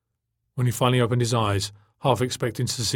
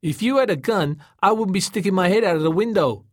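high, raised-voice comeback; calling down; 8 semitones higher, 4 dB louder than usual. A narrator's reading. Tom answers Joey from the floating car, calling down. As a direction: argue back across the distance.

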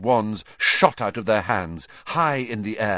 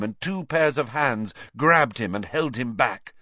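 ordinary delivery, nothing marked

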